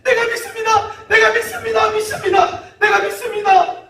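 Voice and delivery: very high, loud repeated cries; burning desperation. Acted. Desperate father pleading for his son, frantic shouting. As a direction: cry it out urgently, over and over. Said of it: "타는 마음".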